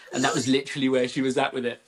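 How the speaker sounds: ordinary talk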